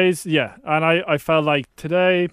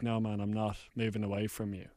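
muttered refusal; 8 semitones lower, 14 dB quieter than usual. Retold or imitated. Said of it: "I was like".

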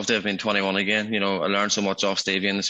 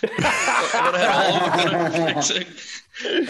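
laughing boast; 8 semitones higher, slower than usual. Real, not acted.

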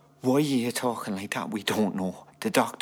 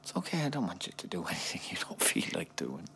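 soft reassurance; 5 semitones higher, 4 dB quieter than usual. Acted. Husband reassuring wife softly.

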